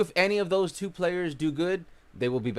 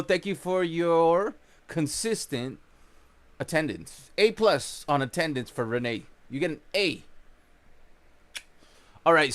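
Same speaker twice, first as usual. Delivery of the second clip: ordinary talk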